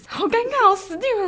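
high panicky inner voice; little girl panicking inside; mock panic. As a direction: squeak it out in a panic.

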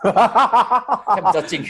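drawn-out words through real laughter; laughing. Real, not acted. Telling friends about borrowing a bike, laughing slowly.

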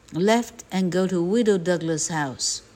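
ordinary delivery

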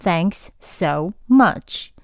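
thanks so much with both s sounds said separately, slowly; robot-like, every sound apart; robotic over-separated. Shown as a demonstration. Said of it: "不要读…你又不是机器人". (像机器人一样把两个s分开读)

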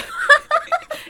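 high, loud sudden laugh; burst of laughter. Real, not acted. Teacher bursting into laughter telling how the pupil cried. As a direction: let a big laugh burst out.

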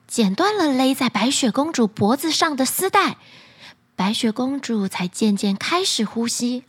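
ordinary delivery

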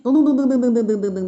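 rapid run of pattering syllables; imitated footsteps. Storyteller mimics the monkey trotting back, pattering. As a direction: rattle off the steps like a drumroll.

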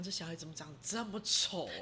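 low, soft inner thought. Retold or imitated. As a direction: mutter it to yourself in shock. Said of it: "我心想".

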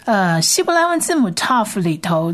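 ordinary delivery

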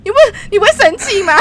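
high, loud indignant question. Real, not acted. Venting to the co-host about the meeting, indignant. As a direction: demand agreement, outraged.

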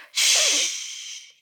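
sharp, high, breathless shush; stern shush. A narrator's reading. Giant silences Sophie while hunting dreams, stern hiss. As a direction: hush her at once, severely. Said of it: "非常严厉的回答".

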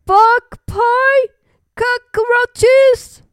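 high, loud rising questions; shocked. A narrator's reading. Animals hear what is in the pie, shocked. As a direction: exclaim in horrified disbelief.

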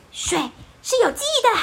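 high, loud, solemn little snowman; earnest snowman voice. Acted. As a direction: explain it very seriously. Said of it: "雪宝认真的说明". (认真的雪人声音)

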